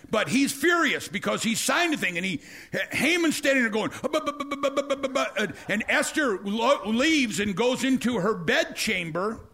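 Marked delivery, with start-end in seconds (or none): 4.0-5.3 high, panicked stutter; sputter, caught out; stammering Haman. Retold or imitated.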